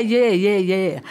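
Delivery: loud, breezy yeah yeah yeah, humouring him; resigned agreement. Retold or imitated. Wife humouring Gareth lost on the bikes, loud breezy yeahs. Humouring husband's wrong directions.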